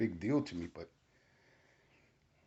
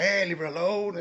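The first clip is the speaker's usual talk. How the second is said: high, louder protective shout; retold yell; 9 semitones higher, 5 dB louder than usual. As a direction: call out to make him back off. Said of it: "And I'm yelling".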